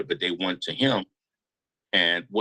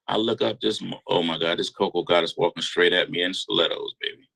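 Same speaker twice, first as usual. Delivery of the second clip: ordinary talk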